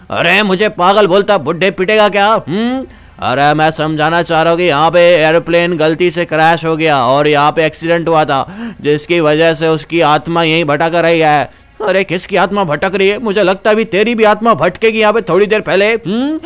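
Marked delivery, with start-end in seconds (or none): 3.2-11.5 put-on low old man explaining a tragedy; low-pitched elder character; old villager. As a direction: voice an old local warning of a past crash.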